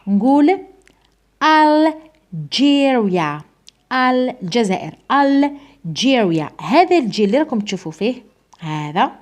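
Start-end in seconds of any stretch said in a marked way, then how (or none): 1.4-3.4 الجيريا stretched slowly, with a clear English "j" in the middle; pronunciation demo. Shown as a demonstration. Teacher shows students how the word is pronounced, slow pronunciation demo. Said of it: "ركز معايا على هذا الحرف كيفاش راني راح ننطقو". 5.1-6.5 الجيريا drawn out slowly with an English "j"; pronunciation demo. Shown as a demonstration. Teacher repeats the word for students, slow pronunciation demo. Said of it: "ركز معايا على هذا الحرف كيفاش راني راح ننطقو".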